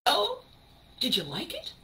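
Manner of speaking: high, bright-eyed eager ask. Retold or imitated. Someone asking a friend about their work, eager. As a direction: fish for praise eagerly. Acted eager asker seeking praise.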